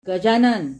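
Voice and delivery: loud furious call, sharp fall; angry shout of a name. Acted. Father storming upstairs to scold the servant, loud angry call. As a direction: bark the name up the stairs in rage. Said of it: "त्यांचा आवाज तापला होता आणि चढला होता".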